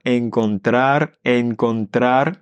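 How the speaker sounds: "encontrar" slow with both r rolled. Shown as a demonstration. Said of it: "on a deux R dedans".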